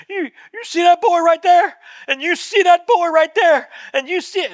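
high, loud mock-crying plea of an overcome elderly woman; imitated tearful cry. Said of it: "and she's crying".

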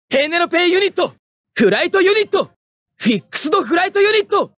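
high, quick, forceful announcement; unit call-out. Acted. Pilot calling out his machine's units, forceful shout. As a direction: call each name out like a launch command.

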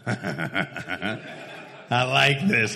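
low, slow, drawn-out relish; savoring relish. Retold or imitated. Brother watching the pickup row unfold, slow relish. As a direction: savor the coming trouble slowly.

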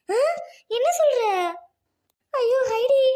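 a high, loud cry of shock and dismay. Acted. Mother hearing of her son-in-law's accident, shocked high cry. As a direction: cry out, stunned by the news.

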